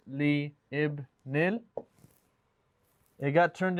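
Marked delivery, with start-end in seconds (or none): 0.1-1.6 slow, li ibnil pulled apart with li and ibnil as separate words; said separately. Shown as a demonstration. Teacher spelling the pieces out for the class, slow and separate. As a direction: pull li ibnil apart, slowly. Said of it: "instead of writing them separately like this".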